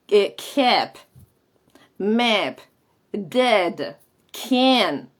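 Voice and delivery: "kept, map, dead, can" with a short frontal A; single words "kept, map, dead, can", front vowel leaned on; choni A. Shown as a demonstration. Teacher demonstrating the choni vowel in "kept, map, dead, can", front and open. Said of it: "Pues esa es la Choni".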